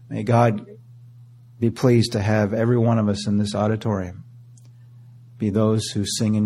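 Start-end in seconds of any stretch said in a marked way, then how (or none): none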